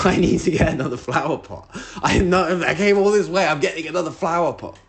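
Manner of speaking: a desperate, squeaky "I need a flower pot"; frantic high-pitched insistence. Retold or imitated. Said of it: "I'm like".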